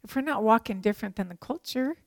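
usual speaking voice

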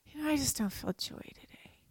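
very soft, whispered little complaint; feeble, hushed mock reluctance; joyless mumbling. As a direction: whisper it like a sulky excuse. Voices a reluctant, joyless believer.

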